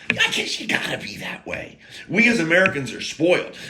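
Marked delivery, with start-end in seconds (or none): 0.1-1.8 whispered aside. Real, not acted. Aside after calling Germans brutal.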